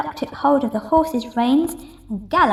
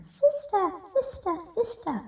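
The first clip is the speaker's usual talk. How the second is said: high, much softer fading echo; echo from cave; 9 semitones higher, 6 dB quieter than usual. Acted. Echo answering from the dark cave. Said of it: "a voice from the cave came back".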